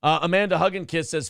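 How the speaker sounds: ordinary delivery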